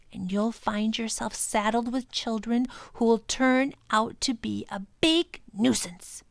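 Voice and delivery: high, scornful creature voice; rude creature voice. Acted. Proc scorning the professor's plan to take the children, high scornful voice. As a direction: warn him off with contempt. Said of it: "said the Proc rudely".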